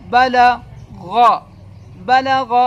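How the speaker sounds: بلغ stretched very slowly and loudly, the غ clearly voiced; teacher modelling بلغ for a beginner; drawn-out teaching demo. Shown as a demonstration. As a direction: hold بلغ long and loud so the غ rings out. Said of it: "یہ غین ہے".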